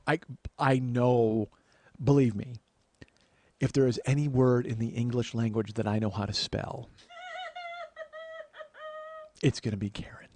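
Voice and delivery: restrained exasperation. Retold or imitated. Nephew told how to spell Karen, restrained exasperation. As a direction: spell out your patience, word by word.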